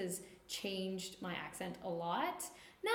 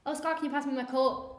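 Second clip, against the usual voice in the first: louder, higher East Coast Canadian lilt; East Coast Canadian accent; 6 semitones higher, 7 dB louder than usual. Retold or imitated. Speaker imitating herself asking a coworker, East Coast Canadian accent. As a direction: slip into broad Nova Scotia East Coast accent. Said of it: "that's the East Coast".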